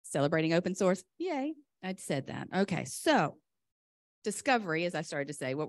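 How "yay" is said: high little yay; cheery yay. Real, not acted. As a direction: cheer it lightly. Celebrating open source.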